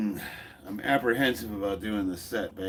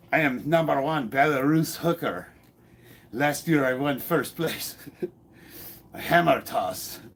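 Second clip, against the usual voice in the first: mock Belarusian athlete, lightly voiced; reading chat as a joking Belarusian lifter, light accent; lightly done Belarus accent; 5 semitones higher, 4 dB louder than usual. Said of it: "I'm gonna say that with an accent".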